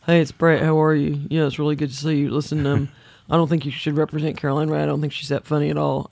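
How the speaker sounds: lightly voiced Brett Butler on the phone; a hint of Brett posing as herself; imitating Brett Butler. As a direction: caller faking being Brett to the managers. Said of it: "I would call up and go, "Hey, it's Brett".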